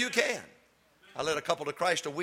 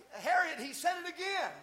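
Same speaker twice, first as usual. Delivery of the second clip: high, hushed mock old lady tattling to her friend; a lightly voiced scandalised church lady; gossiping old lady; 13 semitones higher, 5 dB quieter than usual. Preacher mimicking choir lady tattling to Harriet, high hushed mock. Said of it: "don't do this".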